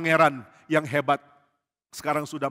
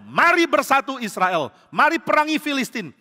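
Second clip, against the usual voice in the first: high, loud rallying oration; rousing speech; 6 semitones higher, 4 dB louder than usual. Acted. Follower demonstrating David's speech to Israel, loud rallying cry. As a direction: proclaim it to a whole nation. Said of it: "kau mengatakan pidato".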